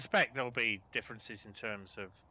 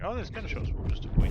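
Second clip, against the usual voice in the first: mock alarmed shout; 9 semitones higher, 8 dB louder than usual. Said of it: "what we used to and going".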